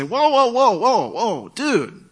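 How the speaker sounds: forceful, high-pitched interruption that levels out; coming in strong. Shown as a demonstration. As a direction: jump in hard to halt an argument. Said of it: "you could come in strong and say".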